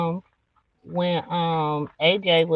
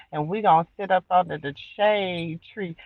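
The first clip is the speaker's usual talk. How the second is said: normal talking voice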